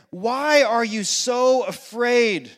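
slow, drawn-out, leaning on why; slow searching question. Acted. Jesus questioning frightened disciples, slow and drawn out. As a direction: ask it slowly and deliberately.

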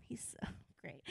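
soft unvoiced whisper; hushed whisper of awe; whispered aside. Real, not acted. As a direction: breathe it out as a private aside.

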